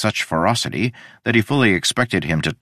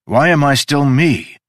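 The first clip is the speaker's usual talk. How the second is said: desperate cry; 8 semitones higher, 5 dB louder, slower than usual. Acted. Udo cries out in despair.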